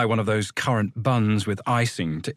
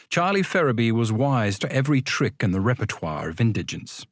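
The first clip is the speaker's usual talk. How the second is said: ordinary delivery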